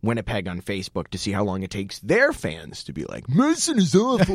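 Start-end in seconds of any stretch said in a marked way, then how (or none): 3.3-4.3 put-on Canadian accent. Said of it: "pretty terrible Canadian accent".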